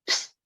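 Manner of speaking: short unvoiced candle-snuff psst; hissed psst. Storyteller imitates cat putting out the candle, short psst. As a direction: imitate a flame being pinched out. He went.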